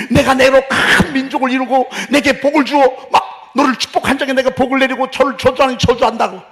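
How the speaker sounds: high, loud preaching at full force; 힘차게 외치듯 설교해. Acted. (목청 높여 외치는 설교)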